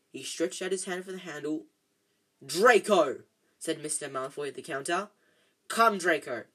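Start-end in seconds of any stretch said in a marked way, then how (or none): 2.4-3.2 loud reprimanding call of a name; stern, raised-voice summons; sharp call. A narrator's reading. Father calling his son sharply. 5.7-6.5 loud, curt summons; firm raised-voice command; stern command. A narrator's reading. Father ordering his son away.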